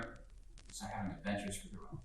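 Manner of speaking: hushed whisper. Acted. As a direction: breathe it out under the breath. Whispered line in the play.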